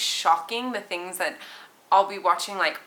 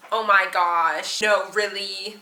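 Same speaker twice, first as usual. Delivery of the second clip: put-on slow, drawn-out valley girl gasp; lightly stretched valley girl gush; a hint of valley girl, slow; 4 dB louder, slower than usual. Shown as a demonstration. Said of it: "I talk like a valley girl".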